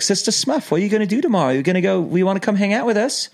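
high-pitched mock Kiwi invitation; New Zealand accent. Zone leader inviting a sister missionary out, New Zealand accent. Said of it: "I'd speak in my New Zealand accent".

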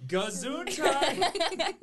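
loud, high, drawn-out mock chant; whole class chorus. Whole class answering a hiccup together, loud chorus. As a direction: boom it out like a crowd in unison. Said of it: "all of them say".

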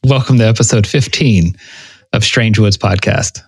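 usual speaking voice

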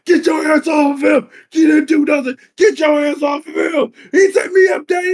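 high, shouted, near-crying plea; imitated desperate yell. Wendell begging his father to stop the grandfather, mock crying shout. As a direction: yell for help like a framed son.

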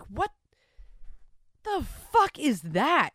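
slow, loud, high disgusted the fuck is that. Real, not acted. Host disgusted by the listener story. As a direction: drag it out in disgust.

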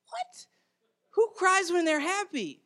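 put-on childlike disbelief. Young boy hearing crying means happiness, baffled and high. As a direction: react like a little kid who can't believe it. Child shocked at happy tears.

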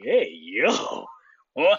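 can't believe what he just heard, laughing; laughing disbelief. Retold or imitated. Reacting to the travel guy, laughing in disbelief. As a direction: laugh through a stunned hey yo what. His own stunned reaction.

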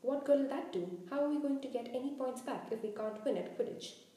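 thundering. Acted. Captain berating his player, thundering. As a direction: fire off exasperated questions, hitting the first hard. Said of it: "Wood thundered".

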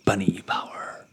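low whispered power call; playful whisper. Pretend. Player invoking a bunny character's power, playful whisper.

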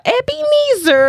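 put-on high, slow little kid saying the name. Mimicking the kid's name.

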